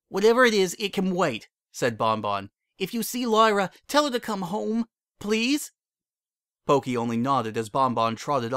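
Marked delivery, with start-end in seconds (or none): 0.1-5.7 high, loud, cutting him off then pleading; no time for anything else; urgent Bon Bon. A narrator's reading. Bon Bon interrupts Pokey, urgent and pleading.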